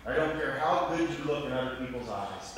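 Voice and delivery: ordinary delivery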